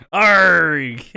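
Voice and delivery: loud, drawn-out mock "arrgh"; imitated commentator yell. Imitating Cole's close-up at the segment's end, loud mock yell. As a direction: belt out a sudden exaggerated yell. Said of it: "and he goes".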